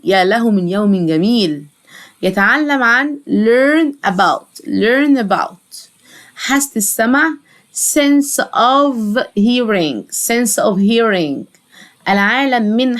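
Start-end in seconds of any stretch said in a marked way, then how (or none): 3.3-4.6 "learn about" stretched, the two words held apart; drawn-out "learn", then a separate "about"; slow pronunciation demo. Shown as a demonstration. Teacher models phrase word by word. 7.7-10.1 "sense of hearing" very slow, word by word; slow pronunciation demo. Shown as a demonstration. Teacher models phrase word by word.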